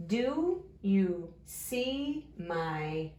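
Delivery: very slow, each word stretched; drawn-out early-reader pacing; word-by-word reading. Shown as a demonstration. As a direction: read along slowly, one word at a time. Sounding out a sentence word by word.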